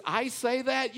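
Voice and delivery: high, incredulous question; retold disbelief. Husband retelling his reply to his wife, incredulous. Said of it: "I says".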